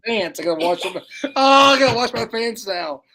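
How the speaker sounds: high, loud cry of disgust; dismayed outburst. Real, not acted. Eater spills smelly fish on own pants, dismayed outburst. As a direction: yell in dismay, pitch jumping up.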